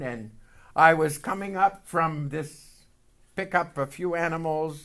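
usual speaking voice

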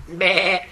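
short imitated goat "meh"; brief comic bleating noise. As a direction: drop in a quick goat bleat. Goat bleat inserted into verse reading.

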